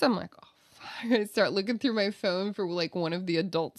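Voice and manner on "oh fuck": panicked whisper. Retold or imitated. Panicking under agent's questioning.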